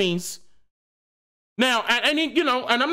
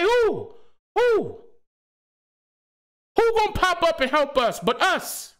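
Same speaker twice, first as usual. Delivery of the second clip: passionate calls; 6 semitones higher than usual. Real, not acted. Rising passionate rant, repeated questions.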